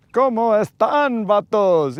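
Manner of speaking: hammed-up bat-bite voice, in Spanish; Spanish in the vampire voice. Greeting his viewers in Spanish, lightly hammed-up vampire voice. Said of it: "Even my Spanish voice sounds like this".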